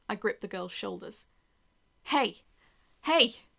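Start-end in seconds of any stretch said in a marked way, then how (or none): 2.0-3.4 loud, raised repeated call; urgent calling out. Acted. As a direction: call sharply to get a dazed child's attention. Calling the dazed girl to attention.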